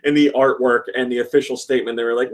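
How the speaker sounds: ordinary talk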